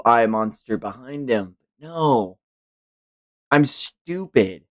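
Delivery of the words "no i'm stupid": usual speaking voice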